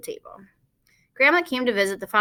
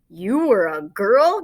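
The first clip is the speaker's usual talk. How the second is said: amazed; 5 dB louder, much slower than usual. A narrator's reading. Fudge amazed at Grandma.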